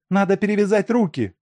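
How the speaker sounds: high, fast shout. A narrator's reading. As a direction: yell it out urgently, up high. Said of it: "закричала Машка". (высокий быстрый крик)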